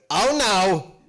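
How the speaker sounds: high, loud theatrical protest; Nathan Lane. Retold or imitated. Actor refusing to play the scene nearly naked, loud high protest. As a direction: put your foot down, loudly and high. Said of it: "Nathan, when he was doing the play, said".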